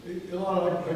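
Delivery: ordinary delivery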